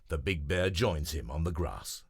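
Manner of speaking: usual speaking voice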